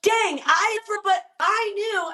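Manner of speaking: high surprised exclaim; startled realisation. Retold or imitated. Herself reacting to all that filmed footage, high and surprised. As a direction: react to the sheer footage, amazed. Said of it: "I was like".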